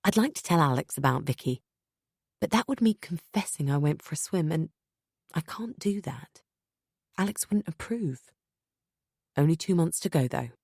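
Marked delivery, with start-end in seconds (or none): 5.3-6.4 slow whisper; secretive whisper. A narrator's reading. Narrator hiding her swim, hushed whisper. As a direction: keep the secret under breath.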